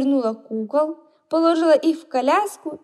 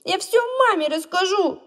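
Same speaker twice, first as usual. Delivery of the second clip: tearful threat; 7 semitones higher, 3 dB louder than usual. A narrator's reading. Hurt boy threatens.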